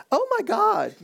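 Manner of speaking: high-pitched excited blurt, hammed-up; mock exclamation. Pastor mocking a wrong reading of Thomas, high exclamation. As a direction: parody an astonished outburst. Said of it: "Thomas is just making an exclamation".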